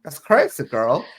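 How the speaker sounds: put-on high, loud, sassy girl voice; sassy exclamation. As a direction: play it up like a sassy friend. Mock sassy repeat of crazy.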